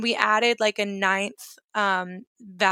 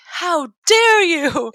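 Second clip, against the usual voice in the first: mock outrage; 8 semitones higher, 8 dB louder, much slower than usual. Indignant reply to her therapist.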